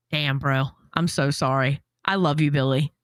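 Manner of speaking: low, hushed mock sympathy; comforting a friend over his haircut in low mock tenderness; mock tender. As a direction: console him in a low, hammed-up tender voice.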